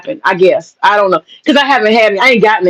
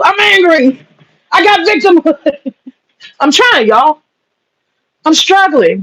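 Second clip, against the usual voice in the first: higher, slow put-on voice of an angry victim; mock angry victim; 5 semitones higher, slower than usual. Going back to the feminist act, a mock angry victim voice. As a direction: ham up a bitter feminist.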